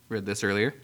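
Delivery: normal talking voice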